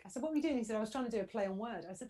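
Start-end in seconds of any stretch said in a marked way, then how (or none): 0.2-0.6 much higher and louder incredulous ask; mock disbelief; incredulous question. Wife quizzing husband in anecdote. 0.8-1.8 louder, a touch higher defensive husband; lightly voiced sheepish husband. Husband explaining in anecdote.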